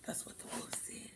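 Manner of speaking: quick whispered aside; a hurried hushed whisper after the wolf's threat. Real, not acted.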